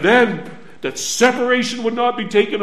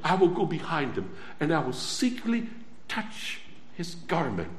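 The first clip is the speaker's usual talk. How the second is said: mild hushed resolve; 4 semitones lower, 9 dB quieter than usual. Acted. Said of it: "And so she said".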